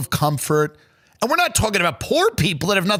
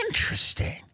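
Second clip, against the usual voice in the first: intrigued murmur; 5 dB quieter than usual. Acted. Intrigued rich listener.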